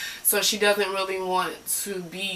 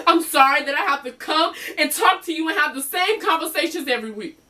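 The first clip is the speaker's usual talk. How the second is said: high, louder, resentful sorry; acted exasperated venting, pitch raised; bitter apology; 8 semitones higher, 4 dB louder than usual. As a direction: throw the apology at the therapist.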